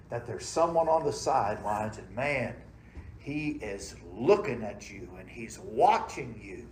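usual speaking voice

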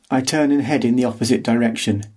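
plain reading voice

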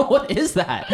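high laughing squeal; laughing in disbelief at her friend; laughing question. Real, not acted. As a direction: ask it through a burst of laughter. Laughing while asking friend.

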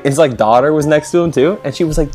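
usual speaking voice

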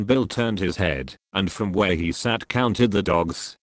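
plain reading voice

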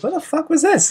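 high-pitched mock disbelief; incredulous outburst, played for laughs; astonished retelling. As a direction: act out being floored by a shock discovery. Retells shock at mom speaking German.

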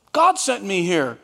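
usual speaking voice

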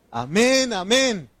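loud, high drawn-out amens; congregational response. Retold or imitated. Preacher voicing the people's answer to Ezra, loud and high. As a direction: answer as a whole crowd, loud. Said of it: "todo el pueblo respondió".

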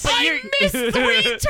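loud, high, slow wail; frantic shout. Pretend. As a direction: wail it like a lost driver. Lost parent in the car.

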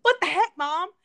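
loud, high cheeky blurt; loud teasing what the heck; mock outburst. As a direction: shout it out as a joking kid. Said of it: "he said it in, like, it, not in a disrespect".

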